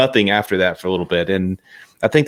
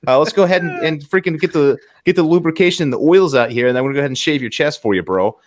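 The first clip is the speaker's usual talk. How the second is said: [name] chatting normally; 6 semitones higher than usual. Acted. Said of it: "[name] was talking normal".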